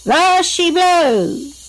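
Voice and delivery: high, long-drawn whale-spotting cry; slow, high pirate holler; calling out. A narrator's reading. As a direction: stretch each word out like a sailor's call. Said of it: "Captain Jared called out".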